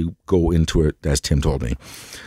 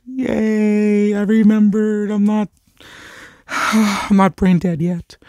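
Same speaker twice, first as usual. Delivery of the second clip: excited; slower than usual. Real, not acted. Cheering at remembering the name.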